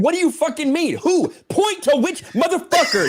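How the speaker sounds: an angry customer shouting at Taco Bell staff; angry yell. Retold or imitated. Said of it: "I was pissed off".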